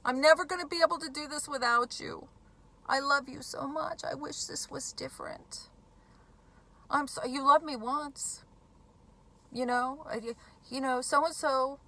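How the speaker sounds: mock-pitiful begging to stay; playing the victim.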